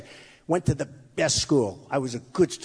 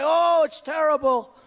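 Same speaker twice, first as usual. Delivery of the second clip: mock critic, drawn out; 8 semitones higher, 3 dB louder, slower than usual. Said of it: "they'll say".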